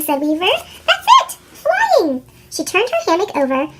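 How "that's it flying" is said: high, loud sudden eureka; excited. Acted. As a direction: burst out with the idea. Spider has an idea.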